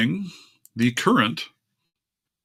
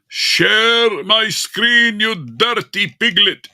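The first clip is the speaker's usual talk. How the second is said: mock scolding; 9 semitones higher, much slower than usual. Real, not acted. Scolding the computer.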